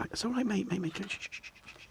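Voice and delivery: high, soft hushing; soothing the cat. Real, not acted. Owner hushes the cat, softly and high. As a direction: settle the cat with gentle shushes.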